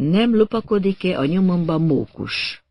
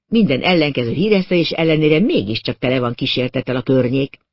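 usual speaking voice

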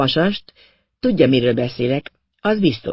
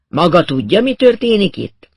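louder, much higher challenge; insistent demand; 5 semitones higher, 5 dB louder than usual. Acted. Inspector presses the narrator for answers, loud and insistent. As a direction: throw the question at them, rising sharply.

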